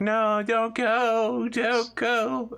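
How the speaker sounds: very high, slow tiny animal plea; pleading little animals. Pretend. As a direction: beg like a small creature, high and drawn out. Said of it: "the animals are just like".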